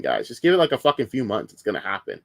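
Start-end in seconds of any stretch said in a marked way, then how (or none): 1.5-2.2 softer, lower muttered "It's gonna happen"; quiet repeat. Real, not acted. Streamer repeats his prediction to himself, soft. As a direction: say it again under your breath.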